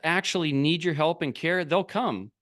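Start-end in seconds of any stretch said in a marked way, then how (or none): none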